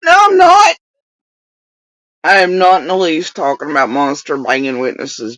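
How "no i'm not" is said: high, loud whiny retort; hammed-up indignant shout; shouted denial. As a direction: yell back in exaggerated protest. Loud retort to the clip's accusation.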